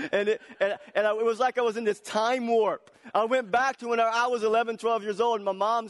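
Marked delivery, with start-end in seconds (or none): 0.0-4.4 laughing. Real, not acted. Laughing while retelling spanking.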